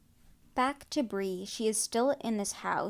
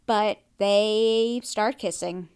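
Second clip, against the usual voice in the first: slow dramatic reveal; 7 dB louder, much slower than usual. Real, not acted.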